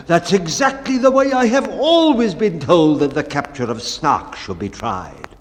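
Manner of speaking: loud, confident captain; a hint of the bellman's bluster; bold bellman. Bellman boldly agreeing with the uncle's method, a touch loud.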